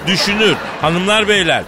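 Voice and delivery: grand announcer intro. Acted. Host presenting the chairman to the studio, grand announcer build-up. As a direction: hype the guest like a ring announcer, swelling as you go.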